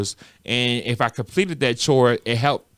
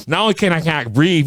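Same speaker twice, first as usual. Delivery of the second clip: ordinary talk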